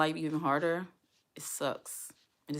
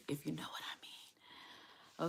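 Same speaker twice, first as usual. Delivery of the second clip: slow, breathy hinting whisper; knowing whisper; 13 dB quieter, much slower than usual. Real, not acted. Vlogger hinting to viewers about her period, in a whisper. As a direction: whisper it like a shared secret. Hinting at Mother Nature joke.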